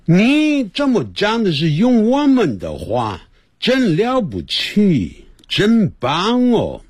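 lightly voiced admiring foreigner, head tilted; impressed foreigner. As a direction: play the impressed foreigner, drawing out the praise. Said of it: "那老外会侧个头来讲".

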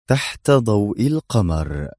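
ordinary delivery, nothing marked

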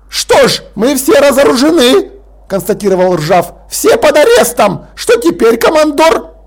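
pompous lord barking an order; growling Lord Rust. Acted. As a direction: snap the order like an outraged old aristocrat. Rust's sarcastic outburst.

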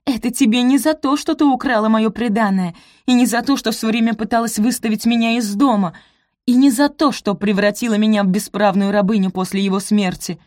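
loud, furious spitting rage; furious hiss. Acted. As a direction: hurl the accusations in fury. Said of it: "злобно прошипела".

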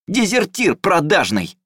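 high, slow furious yell; furious shout. A narrator's reading. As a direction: spit out the insult. Brother keeps attacking.

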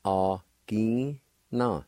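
a-gi-na spoken very slowly, syllable by syllable. Shown as a demonstration. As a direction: spell out a-gi-na slowly. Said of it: "The second part".

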